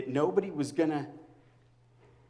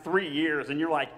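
normal talking voice

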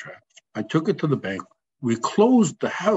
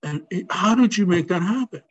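higher, marvelling retold friend; amazed; 6 semitones higher than usual. Friend marvelling to Teddy about his new house, amazed. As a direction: sound amazed at how fast it happened.

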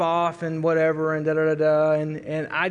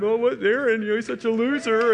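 taunting little brother; 3 semitones higher than usual. Retold or imitated. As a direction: tease like a bratty little brother. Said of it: "My little brother is taunting me".

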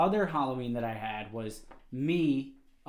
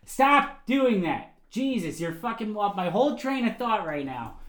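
real exasperated outburst; 5 semitones higher than usual. Real, not acted. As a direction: burst out in irritation at a distraction. Snaps at a distracting guest.

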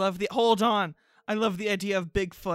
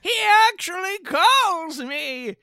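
higher, louder, quavering grandma; 9 semitones higher, 5 dB louder than usual. Acted. Grandma bragging that Bigfoot calls her, higher and louder. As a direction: play the excited old grandma.

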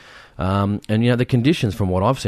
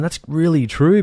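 agreeing aloud; 8 semitones higher than usual. Retold or imitated. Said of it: "I was there going".

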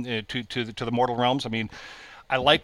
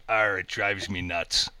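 low, slow pirate growl for a punchline; 5 semitones lower, 3 dB quieter, slower than usual. Acted. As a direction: deliver it like a joke-book pirate. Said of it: "Pirate goes".

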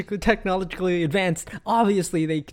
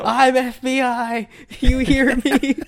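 high, slow declaration of authority; mock bluster; 8 semitones higher, 3 dB louder, slower than usual. Agent pulls rank on a caller, mock bluster. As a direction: puff it up high and slow, near laughing. Acting out Peter's unhinged threat.

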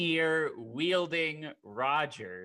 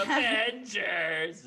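high, loud, drawn-out rallying yell; long heroic mock war cry; battle cry; 8 semitones higher, 6 dB louder, much slower than usual. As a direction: shout it like a superhero leading the charge. Acting out the film's battle cry.

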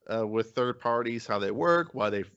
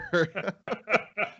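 high laugh; 9 semitones higher than usual. Real, not acted. Laughing at own joke.

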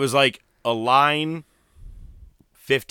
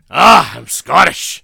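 loud, slow, hammed-up Scottish accent; bad Scottish accent; 5 semitones higher, 12 dB louder, slower than usual. Showing off his poor accent to co-host, bad Scottish accent. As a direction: bellow it in a badly faked Scottish accent. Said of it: "not as bad as your Scottish".

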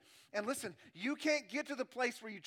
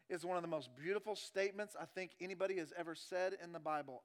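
normal talking voice

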